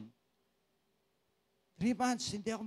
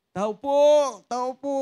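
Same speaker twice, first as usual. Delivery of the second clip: loud call from outside the gate, higher; 7 semitones higher, 11 dB louder, slower than usual. Retold or imitated. Visitor at the door calling to the owner, loud call. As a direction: hail the house from outside.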